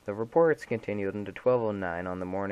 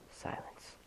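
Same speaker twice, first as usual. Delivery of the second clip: ordinary delivery, nothing marked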